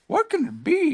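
high, wondering bear voice; puzzled in a high-pitched voice; Pooh. A narrator's reading. Pooh wonders about the noise, high voice. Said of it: "he thought".

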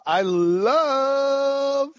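"love" climbing high and held very long; drawn-out love. Real, not acted. Teasing long build-up to reply.

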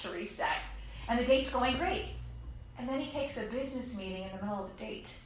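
ordinary delivery

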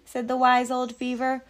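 ordinary delivery

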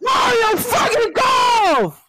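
high, loud, slow excited yell; hype shout. Real, not acted. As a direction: scream it like a fan. Hype shout over game news.

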